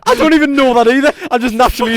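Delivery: very high, loud, helpless laughing; squealed out while cracking up; laughing protest. Real, not acted. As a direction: protest through a fit of laughter.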